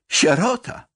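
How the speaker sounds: crying out. Acted. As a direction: call out "Sierota!" in anguish. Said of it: "zawołał Maciek". (wykrzyczane „Sierota!”, wyżej i głośniej)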